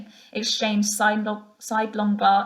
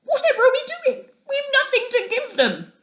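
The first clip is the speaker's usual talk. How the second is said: high, fast tearful wail; on the edge of tears; near tears; 3 dB louder than usual. A narrator's reading. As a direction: Mole panicking with nothing to offer. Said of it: "with tears impending".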